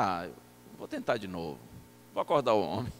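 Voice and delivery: low, soft inner voice; pensando consigo. Retold or imitated. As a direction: mutter a decision to yourself. Pensando sozinho no quarto. (falando baixinho consigo mesmo)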